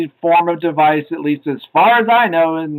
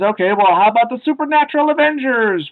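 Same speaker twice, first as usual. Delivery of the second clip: imitated show pitch; 7 semitones higher than usual. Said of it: "he's pitching a show idea".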